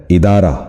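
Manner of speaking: ادارہ with "i" first, idaara; correct pronunciation. Shown as a demonstration. Teacher repeating for viewers the correct ادارہ, idaara. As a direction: say ادارہ as idaara, not adaara. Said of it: "درست تلفظ ہے".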